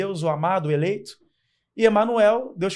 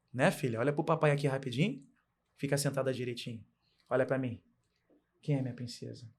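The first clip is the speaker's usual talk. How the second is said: tender fatherly talk; 2 semitones lower, 5 dB quieter than usual. Real, not acted. Father asking his daughter to look at him, soft and tender.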